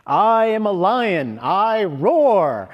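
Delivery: loud, high, ringing declaration; energetic affirmation. Retold or imitated. Master rousing the whole audience, loud energetic affirmation. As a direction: proclaim it with lots of energy, drawn-out roar. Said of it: "he would tell everyone with lots of energy".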